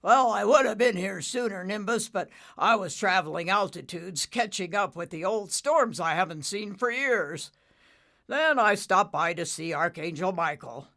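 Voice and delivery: lightly voiced old storm father; father cloud.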